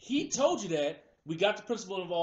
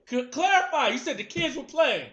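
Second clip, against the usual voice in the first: high, loud disbelieving challenge; challenging; 5 semitones higher, 7 dB louder than usual. Real, not acted. Friend challenging the park story, loud and incredulous. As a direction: call him out on it.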